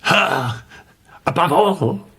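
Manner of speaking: high, forced disbelieving echo; strained question. A narrator's reading. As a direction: force the words out, shaken. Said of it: "made an effort and said".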